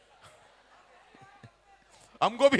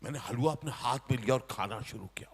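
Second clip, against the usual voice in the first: whisper; 8 semitones lower, 8 dB quieter, faster than usual. Acted. Eating halwa secretly.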